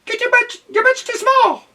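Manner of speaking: very high, loud cat voice; a haughty cat sneering. A narrator's reading. Cat telling duck off in a high voice. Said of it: "Oh, this is the cat".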